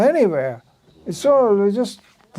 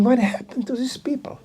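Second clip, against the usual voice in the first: a hushed, astonished whisper, pitched up; amazed whisper; 8 semitones higher, 5 dB quieter than usual. Retold or imitated. Young newcomer marvelling at the devotees, whispered in awe. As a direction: breathe it out in disbelief.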